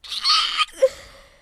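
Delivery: very high, loud gasp; sharp gasp. Real, not acted. Reaction to game.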